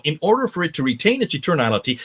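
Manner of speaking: normal talking voice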